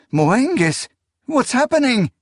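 high, frightened question; panicked cry. A narrator's reading. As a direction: call out in rising panic. Frightened woman as baby is taken.

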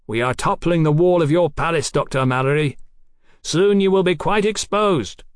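high, declaiming menace; projected threat. Acted. Besieger threatens the doctor from below, calling out. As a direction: call out a threat across the ramparts. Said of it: "A warped and muffled voice".

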